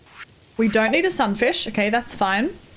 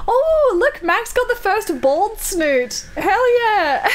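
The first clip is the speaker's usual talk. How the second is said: high, drawn-out delighted cheer; real excitement; 9 semitones higher, slower than usual. Real, not acted. Streamer cheering a viewer's first bald snoot, high and excited. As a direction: celebrate it, pitch leaping up from the first "oh".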